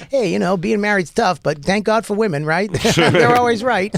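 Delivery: put-on high, eager neutral accent; no Boston accent. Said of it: "I dropped the Boston accent".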